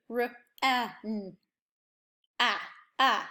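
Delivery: ran broken into R, a, n, then the short a held alone; sounding out. Shown as a demonstration. Teacher sounding out ran letter by letter for the class. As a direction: pull apart the sounds of ran. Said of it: "Let's listen to it".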